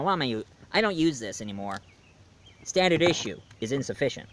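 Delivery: usual speaking voice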